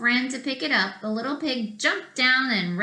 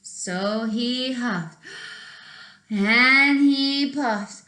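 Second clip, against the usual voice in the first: slow, growing louder and higher; building huff and puff; 5 semitones higher, 4 dB louder, much slower than usual. A narrator's reading. Narrator acts out the wolf blowing, slow and building. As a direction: build it up breath by breath.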